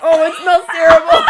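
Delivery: loud, laughing disgust; disgusted by smell. Real, not acted. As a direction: laugh out the horror. Reacting to curdled liqueur smell.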